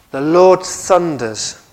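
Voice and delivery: loud, slow proclamation; declamatory scripture reading. Real, not acted. Preacher reading Joel out to the congregation, loud slow declamation. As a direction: proclaim it slowly and forcefully.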